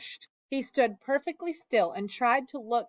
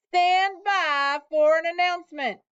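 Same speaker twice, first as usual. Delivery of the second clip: higher, slow, booming announcer; loudspeaker voice; 7 semitones higher, 5 dB louder than usual. A narrator's reading. Loudspeaker telling the crowd the pig is unloaded, booming. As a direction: boom it out over the fairground. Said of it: "boomed the voice of the loudspeaker".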